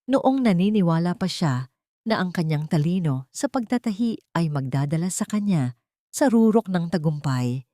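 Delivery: usual speaking voice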